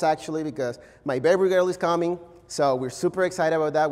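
ordinary talk

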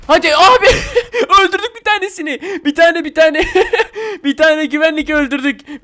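high, loud shouts breaking into laughter; ecstatic triumph. Real, not acted. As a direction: whoop and laugh over a game victory. Celebrates beating a guard in game. (kahkahalarla coşkulu zafer sevinci)

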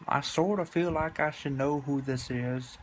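ordinary delivery

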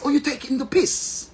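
mock British accent in a high register; imitated British woman, pitched well up; big British voice. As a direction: go high and British, all affronted questions. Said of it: "get a big British voice".

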